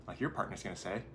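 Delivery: ordinary talk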